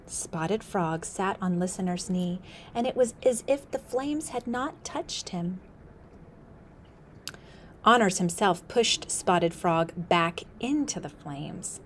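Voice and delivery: plain reading voice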